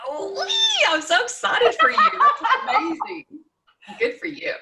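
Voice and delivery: high, loud excited squeal; host squeals with joy at the guest's first pole lesson; thrilled squeal. Real, not acted. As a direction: squeal with delight, laughing.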